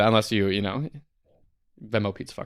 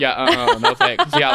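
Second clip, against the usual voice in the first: laughing; 4 dB louder than usual. Real, not acted. Joking reply, laughing.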